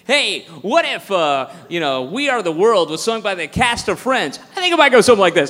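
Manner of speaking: loud, hammy open-mic comic voice; mock hack comic. Mocking the openers before him, hammy comic voice. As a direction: ham it up like a cheesy club comic.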